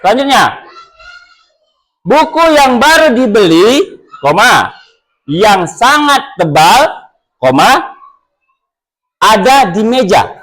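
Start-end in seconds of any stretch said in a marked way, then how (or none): 2.0-10.3 phrases raised, then a pause at each "koma"; comma pauses demonstrated. Shown as a demonstration. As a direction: clear teacher demo of pausing at commas. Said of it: "Beda pengucapannya".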